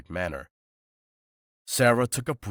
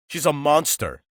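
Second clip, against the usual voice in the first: monster punched higher and louder; stress on monster; 8 semitones higher, 7 dB louder than usual. A narrator's reading. Jason warning Damon about Sarah, hitting monster hard. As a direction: lean hard on the word monster. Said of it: "placed a huge amount of emphasis on the last word".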